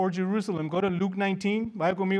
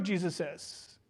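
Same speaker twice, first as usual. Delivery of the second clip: ordinary talk, nothing marked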